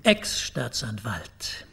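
dry correction. Acted. Pointedly corrects the visitor's title.

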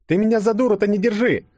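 very high, indignant outburst; flaring up. A narrator's reading. Yulia refusing Denis's feigned surprise, flaring up. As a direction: flare up in a high girlish pitch. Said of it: "вспыхнула ёжик".

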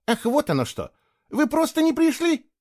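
high, accusing question; reproachful. A narrator's reading. Boy asking friends who never came, reproachful. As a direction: reproach them for letting you down. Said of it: "с упрёком спросил он".

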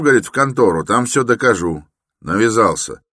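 high, loud, heated account; indignant workman. A narrator's reading. Workman reports Raskolnikov's odd visit, indignant and loud. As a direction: complain loudly about a pestering stranger.

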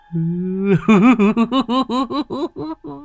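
high manic cackle; high crazed laugh. Acted. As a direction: cackle like a madman. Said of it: "nó cười hình hạch".

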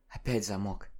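тихо, заметно тише; шепни, чтобы не услышали; вполголоса. Acted. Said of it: "вполголоса сообщила девушка". (вполголоса)

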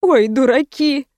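slow, drawn-out, lightly acted weeping; a touch of crying, stretched out; tearful exclamation. A narrator's reading. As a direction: wail it mildly through happy tears. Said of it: "а потом вдруг заплакала".

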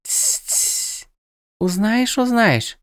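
high, lilting birdlike goddess; sing-song, echoing her own words; bird goddess voice. A narrator's reading. Goddess hushes the mage.

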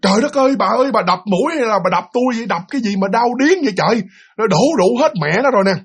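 high-pitched mock outcry; retold yell. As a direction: act out a pained, indignant complaint. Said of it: "ba mới la lên".

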